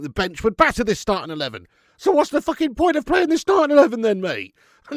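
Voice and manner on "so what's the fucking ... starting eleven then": much higher, loud indignant yell; exasperated football fan; hammed-up fury; mock outrage. Real, not acted.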